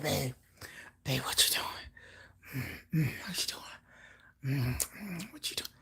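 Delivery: breathy low whisper; imagined boyfriend whisper. Retold or imitated. Vlogger imitating her imagined man whispering on the phone. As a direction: breathe it low like a man calling late at night. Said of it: "he be like".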